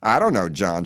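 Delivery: plain reading voice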